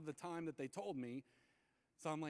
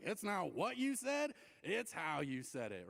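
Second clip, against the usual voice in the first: mock reproach; 2 semitones higher, slower than usual. Mimicking people who scold your tone, mock reproach.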